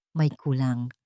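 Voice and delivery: plain reading voice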